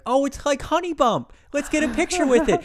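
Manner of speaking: high, gushing imitation of a clueless fan; high-pitched mock delight; mock naive fan. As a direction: put on a silly high voice, cooing. Voicing naive fans meeting a bear.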